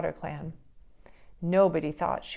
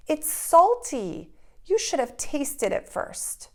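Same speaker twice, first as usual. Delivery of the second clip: scolding; 8 semitones higher, 5 dB louder, slower than usual. Acted. First Woman scolds Frog.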